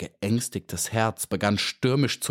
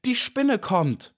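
high, drawn-out cry of alarm; screamed warning; 12 semitones higher, slower than usual. A narrator's reading. Bee sees the spider coming down, alarmed scream. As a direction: cry it out high and slow, in fright. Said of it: "schrie sie".

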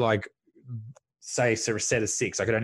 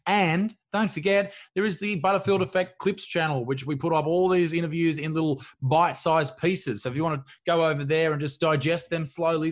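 hyped outro plug; 5 semitones higher than usual. Acted. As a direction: plug the channel like an announcer.

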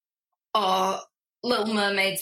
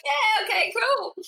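acted casual reaction; 14 semitones higher than usual. Guest acting out a reaction to faces, mock agreement. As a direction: pitch it up as a quoted reaction.

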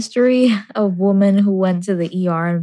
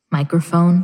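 SpongeBob embarrassed voice; 2 semitones lower than usual. Pretend. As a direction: gasp then a shy, sheepish word. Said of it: "Just say microphone".

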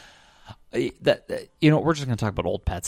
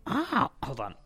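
lightly attempted Marge-style voice, softer; half-found cartoon mom impression; 3 semitones higher, 6 dB quieter, slower than usual. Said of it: "I can't do my Marge lately".

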